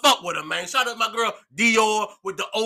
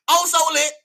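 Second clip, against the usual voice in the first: excited hype call; 10 semitones higher, 4 dB louder than usual. Real, not acted. Brand hype again.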